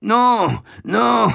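non aigus et glapis, à peine une voix d'homme; il glapit comme un chiot effrayé; glapissement. A narrator's reading. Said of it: "glapit l'amoureux". (l'amoureux glapit son refus, voix aiguë)

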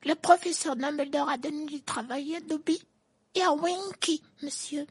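very high, excited elf squeak. A narrator's reading. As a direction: squeak it out, thrilled. Dobby's held voice.

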